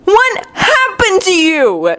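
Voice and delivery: high, drawn-out horrified question; appalled, stretched-out question; aghast demand. A narrator's reading. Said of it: "Naruto demands, sounding aghast".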